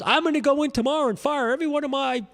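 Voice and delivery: high mock accent, quoting the boss; imitating Felix's accent. Crew chief mimicking Felix threatening to fire his guys, mock accent. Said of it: "he's got that accent".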